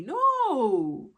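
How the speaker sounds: loud, firm no; emphatic no. Real, not acted. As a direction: stop the listener cold. Rejecting the quoted parent.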